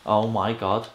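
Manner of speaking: usual speaking voice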